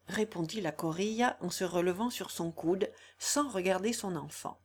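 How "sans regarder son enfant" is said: plain reading voice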